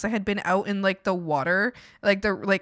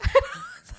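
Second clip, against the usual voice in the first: a sudden high giggle; squeaky real laugh; high burst of laughter. Real, not acted.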